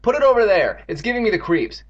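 repelled order. Acted. Girl repelled by the bucket.

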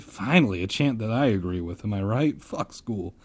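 usual speaking voice

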